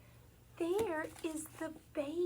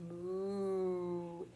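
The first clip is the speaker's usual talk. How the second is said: cow lowing; 7 semitones lower, 4 dB quieter than usual. Pretend. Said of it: "the big cow lowed".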